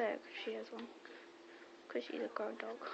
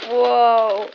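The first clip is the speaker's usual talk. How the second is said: startled whoa; 16 dB louder than usual. Real, not acted. Startled by the dog's tug.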